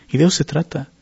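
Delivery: ordinary delivery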